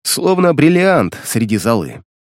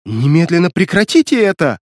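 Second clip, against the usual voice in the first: alarmed demand; 6 semitones higher than usual. Acted. Linet pleads in fear.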